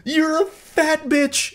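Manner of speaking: high, slow, wailing mock tantrum; a grown man's diaper meltdown, hammed up; mock toddler meltdown. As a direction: whine it like a baby losing it. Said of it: "breaks down into his fucking soiled diaper meltdown".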